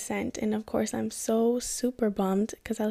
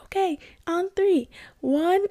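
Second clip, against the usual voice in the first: much higher, louder quick count; brisk countdown; 8 semitones higher, 4 dB louder, slower than usual. Retold or imitated. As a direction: count her down and jump. Instructor counting before jump.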